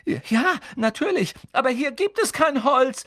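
high, loud panicked shout; girl yelling desperately at the boys. Acted. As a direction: yell it in despair. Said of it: "schrie Hermine händeringend".

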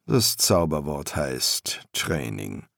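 low, slow master's voice; unimpressed master embalmer; master embalmer, dry. A narrator's reading. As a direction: say it low and measured, as a seasoned master.

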